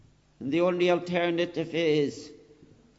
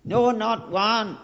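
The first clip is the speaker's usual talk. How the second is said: NO, NOT ONE hammered slow, loud and higher; emphatic; 5 semitones higher, 6 dB louder, slower than usual. Shown as a demonstration. Preacher stressing Paul's words to the congregation, slow and loud. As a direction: lean hard on each word of "No, not one". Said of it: "See the emphasis".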